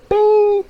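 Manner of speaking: very high, loud bell-like bing; bing sound. Pretend. Marking the moment he quit, a sharp high bing. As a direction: ring it out like a bell going off.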